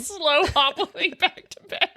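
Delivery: laughing through the words. Real, not acted. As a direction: giggle as you say it.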